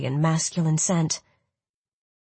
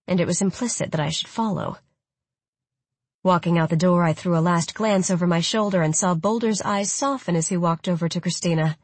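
ordinary delivery

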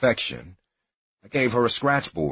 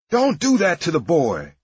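high, loud holler from another room; 9 semitones higher, 4 dB louder than usual. Retold or imitated. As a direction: yell it at them to stop. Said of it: "she hollered".

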